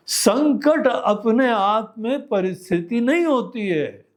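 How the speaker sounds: usual speaking voice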